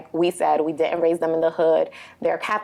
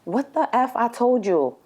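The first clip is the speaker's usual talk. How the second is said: imitating an angry curse-out, slightly higher; mock an angry challenge, not shouted; retold cursing out; 6 semitones higher than usual. Mother imitating someone cursing out her son, mild mock anger. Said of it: "I hear someone cursing him out".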